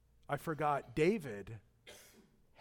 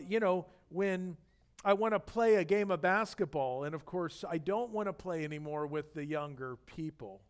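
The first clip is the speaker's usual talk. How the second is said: ordinary talk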